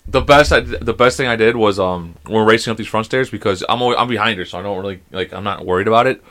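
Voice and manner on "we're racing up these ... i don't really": ordinary talk, nothing marked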